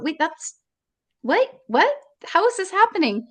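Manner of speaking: high, rising pitch of retold panicked disbelief; stunned disbelief. Mother reliving disbelief putting her baby in the coffin, high and stunned. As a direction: voice climbs high as the shock returns.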